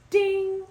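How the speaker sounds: high, loud imitated notification ding; bell ding sound. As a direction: shout a high bell chime. Imitating notification bell after subscribe.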